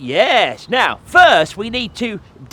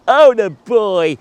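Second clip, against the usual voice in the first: ordinary talk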